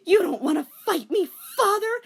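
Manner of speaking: high loud shouted challenge; defiant shout. A narrator's reading. Daughter challenging her father, loud shout. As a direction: shout it through tears.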